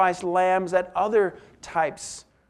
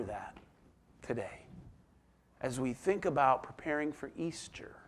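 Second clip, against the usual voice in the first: whisper; 4 semitones lower, 9 dB quieter than usual. Real, not acted. Soft closing thought to congregation.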